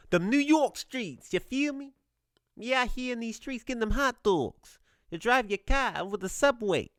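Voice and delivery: put-on New York accent. Talking up mom's New York streets in a mock New York accent. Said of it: "I like the New York accent".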